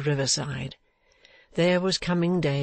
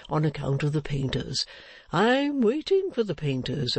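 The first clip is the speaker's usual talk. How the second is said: put-on good-natured old fellow, swooping intonation; 3 semitones higher than usual. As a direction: invite them in as a chatty sexton.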